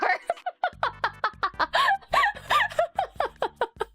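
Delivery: high, prolonged real laughing fit; long helpless laugh. Real, not acted. Storyteller recalling the broken car pieces, laughing helplessly. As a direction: laugh until you can barely breathe.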